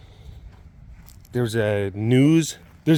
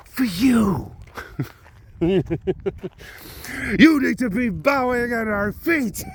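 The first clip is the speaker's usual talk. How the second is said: mock rally speech; 5 semitones higher than usual. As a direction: rouse a crowd with drawn-out, high emphasis.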